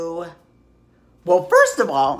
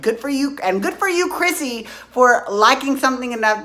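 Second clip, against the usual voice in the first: cheering; 6 semitones higher, 4 dB louder than usual. Real, not acted. Cheering Chrissy Teigen.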